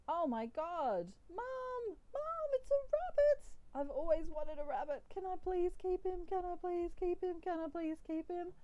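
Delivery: high, loud squeal for Mom; excited pleading kid. Acted. Alien plays kid finding rabbit.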